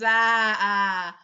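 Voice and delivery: loud drawn-out surprised "ah"; surprised exclamation. Acted. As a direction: catch sight of an old friend mid-boast. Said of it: "she looks over and you and goes".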